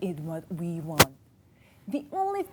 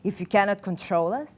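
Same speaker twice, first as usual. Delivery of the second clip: ordinary talk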